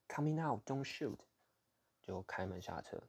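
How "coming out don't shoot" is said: panicked shout. Retold or imitated. To the armed officer behind him, a frightened shout. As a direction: call out fast so he won't fire. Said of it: "我就大喊".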